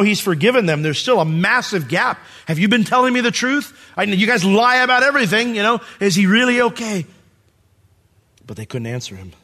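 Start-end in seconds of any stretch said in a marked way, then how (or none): 2.5-7.1 high, loud, accusing questions; distrustful demand. Acted. Joseph questioning untrustworthy brothers about his father, accusing demand. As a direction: press them as proven liars.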